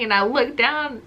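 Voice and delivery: usual speaking voice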